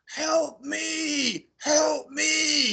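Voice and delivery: high, wailing impression of a spirit; ghostly voice. Showing friends how the night voice sounded, ghostly wail. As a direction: call out long, eerie pleas. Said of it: "you know, like that".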